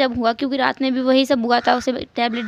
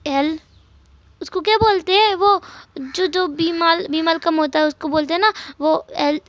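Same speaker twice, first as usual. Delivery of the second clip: put-on a small boy asking his sick aunt, higher pitch; little boy's voice; 4 semitones higher than usual. Narrator voices little boy Jingi. (ऊँची, भोली बच्चे की आवाज़)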